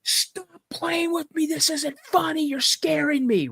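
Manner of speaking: very high mock scream, volume held back; retold scream. Scared boy begs dad to quit teasing, retold scream. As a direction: plead shrilly like a frightened boy. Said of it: "screaming at the top of my lungs".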